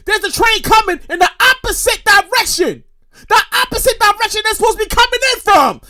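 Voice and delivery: high-pitched, worked-up retold disbelief; mock incredulous. Rider sees train in opposite direction, incredulous. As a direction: climb high as if baffled by the sight.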